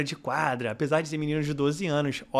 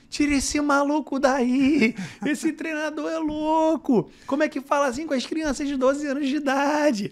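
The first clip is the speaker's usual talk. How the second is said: higher, slow indignant outcry; outraged rival parents; 11 semitones higher, slower than usual. Retold or imitated. Rival team's parents shouting at the coach, indignant and higher. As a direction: play the rival parents demanding the crazy coach be removed. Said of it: "os responsáveis do Correios falaram assim".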